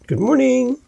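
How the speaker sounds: "good morning" sung up high, ending on a rise; sing-song rising greeting; upspeak. Shown as a demonstration. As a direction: lilt "good morning" upward. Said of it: "that kind of ring to their voice".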